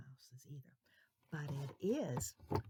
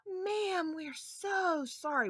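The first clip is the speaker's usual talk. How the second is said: put-on higher, sorry little boys; apologetic boys; 11 semitones higher, 8 dB louder, slower than usual. Boys to the lady, apologetic. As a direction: plead sorry like a child. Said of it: "and they said".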